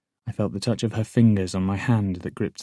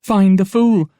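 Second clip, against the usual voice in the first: shout; 12 semitones higher, 11 dB louder than usual. Acted. Patience shouts an accusation.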